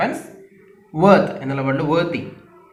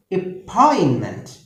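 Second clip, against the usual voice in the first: Appointment slow, second syllable high and long; second-syllable stress; 4 semitones higher, slower than usual. Shown as a demonstration. Teacher models the word, second syllable of appointment stressed. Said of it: "രണ്ടാമത്തെ syllable stress".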